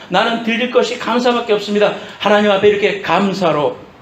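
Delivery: fervent declaration, pitch climbing high; impassioned preaching. Real, not acted. Preacher confessing God's goodness before congregation, impassioned preaching.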